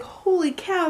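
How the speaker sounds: amazed. Retold or imitated. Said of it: "I was just like".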